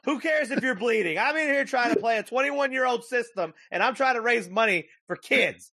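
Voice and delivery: high whiny put-on voice, laughing through; whiny selfish streamer. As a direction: play a petulant gamer who won't be bothered.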